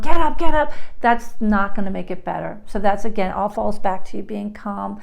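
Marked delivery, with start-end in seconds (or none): 0.0-0.7 loud, high, impatient get up; mock parent yelling, much louder. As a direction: yell it like the parent you shouldn't be. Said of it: "Yelling at them".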